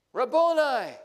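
high, loud joyful cry; cried out. A narrator's reading. Mary recognizing Jesus at the tomb, crying out loud. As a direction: call out in sudden recognition. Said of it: "cried out in Aramaic".

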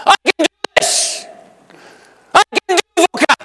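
high, loud climax of "I can do this"; rising shout. Shown as a demonstration. Preacher leading congregation to a loud high "I can do this". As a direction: build to a triumphant cry. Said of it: "Can you say that with me?".

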